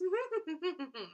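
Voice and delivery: high imitated laugh through the nose. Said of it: "Some people laugh through their noses".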